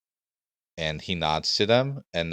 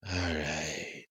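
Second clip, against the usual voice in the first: soft, drawn-out servant reply; hushed guard acknowledgement; creepy guard; 8 dB quieter than usual. Acted. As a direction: stretch it out quietly as the escort. Game master voices the escort replying.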